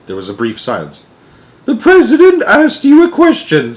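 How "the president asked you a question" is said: put-on very high, loud, icy old nanny. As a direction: freeze him with every word. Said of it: "in an icy voice".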